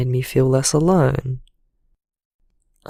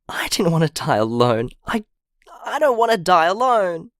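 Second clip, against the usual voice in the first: voice breaking; 5 semitones higher than usual. Acted. Recalling nearly dying, breaking down.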